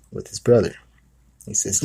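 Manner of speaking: ordinary talk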